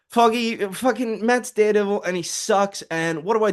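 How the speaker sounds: high, far put-on girlfriend voice, upset; acted Heather. Heather complaining to Foggy about Matt, high and whiny.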